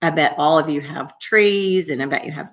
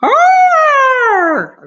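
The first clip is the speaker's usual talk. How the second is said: high, loud imitated roar; big lion roar; 8 dB louder than usual. Teacher shows children lions' noise, big loud roar. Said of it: "It's kind of a big sound".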